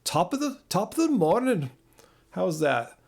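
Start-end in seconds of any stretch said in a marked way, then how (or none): 0.0-1.7 high, lilting mock Irish accent; Scottish-Irish accent. Shown as a demonstration. Streamer trying an Irish phrase for viewers, Scottish-Irish accent. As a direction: put on a singsong Irish brogue. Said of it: "nebulously Scottish slash Irish accent".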